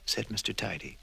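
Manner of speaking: ordinary delivery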